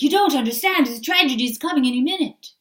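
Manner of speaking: loud, fast mock panic; doom-laden alarm. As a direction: rush it like disaster is imminent. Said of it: "And some of you are like".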